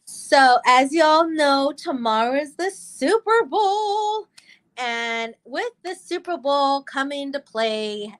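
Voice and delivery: host announcement. Acted. Introduces the topic.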